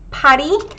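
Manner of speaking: 파리 with a strong aspirated p; 파리 said pa-ri, Korean style; Korean Paris. Shown as a demonstration. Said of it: "you'll hear this pronunciation way more than 파리".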